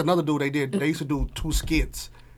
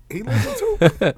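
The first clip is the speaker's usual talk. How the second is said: laughing; 6 semitones higher, 6 dB louder than usual. Retold or imitated. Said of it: "I'm like".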